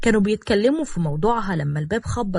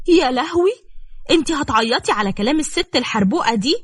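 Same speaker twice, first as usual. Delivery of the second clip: shocked gasp; 6 semitones higher than usual. Acted. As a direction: gasp it out, stunned she is crying. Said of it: "شهقت نبيلة بصدمة".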